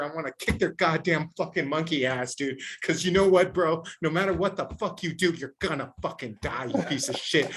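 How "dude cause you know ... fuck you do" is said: ordinary talk, nothing marked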